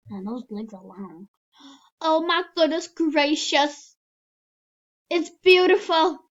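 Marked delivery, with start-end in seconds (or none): 2.0-6.2 high, loud oh-my-goodness wonder; loud awe. Real, not acted. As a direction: gush over it loudly. Admiring avatar outfit.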